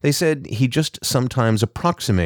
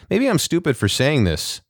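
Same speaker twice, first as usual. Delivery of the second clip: higher, hesitant Kyle voice; unsure of himself, speaking up higher; uncertain; 8 semitones higher than usual. A narrator's reading. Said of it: "Kyle said uncertainly".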